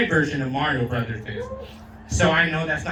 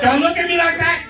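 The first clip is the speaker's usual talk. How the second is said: mock indignant shout; 11 semitones higher, 6 dB louder than usual. Real, not acted. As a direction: yell at Raven in fake outrage. Again to Raven.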